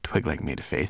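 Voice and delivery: plain reading voice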